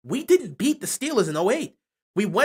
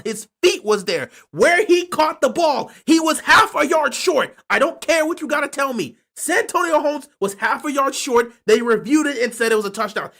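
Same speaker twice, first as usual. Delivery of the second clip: heated rant; 6 semitones higher, 5 dB louder than usual. Real, not acted. Fan ranting about disputed touchdown.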